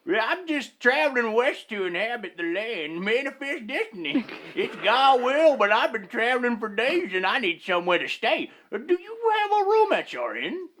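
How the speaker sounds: high, far-off put-on traveler voice; weary traveler. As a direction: play the polite traveler way up high. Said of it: "and the Weary Traveler".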